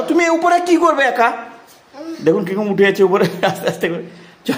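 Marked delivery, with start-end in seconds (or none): 0.0-1.3 much higher and slow, teasing question. Real, not acted. Asks toddler on stairs.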